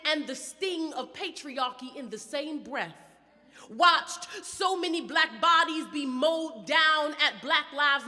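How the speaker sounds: intense, rhythmic poetry performance; spoken word poetry. Acted. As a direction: perform it like a slam poet.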